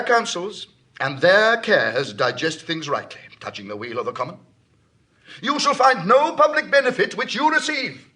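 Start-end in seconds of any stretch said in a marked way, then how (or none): 5.4-8.1 rousing declaration. Acted. Rousing the crowd, rising.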